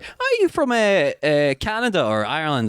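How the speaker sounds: high mock puzzled Aussie asking; imitating clueless locals guessing the accent; high, hesitant questioning voice; puzzled Australian. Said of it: "They're like".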